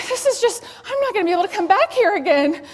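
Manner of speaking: high, loud, fast panic; a high, loud, hurried inner panic; panicked inner thought. Retold or imitated. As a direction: race through the thought in alarm. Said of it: "I'm trying to think".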